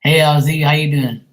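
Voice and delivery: loud, high-pitched hello; called-out greeting. Real, not acted. Greeting someone who turns up mid-chat, calling out loudly.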